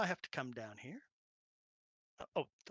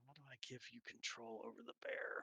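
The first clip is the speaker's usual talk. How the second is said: whisper; 10 dB quieter than usual. Real, not acted. Muttering to himself.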